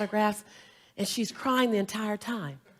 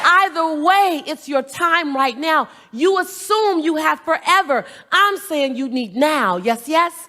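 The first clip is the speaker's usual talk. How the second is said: higher, slow, emphatic rally; motivational preaching; 6 semitones higher, 7 dB louder, slower than usual. Acted. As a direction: preach it to the crowd. Motivational call and response.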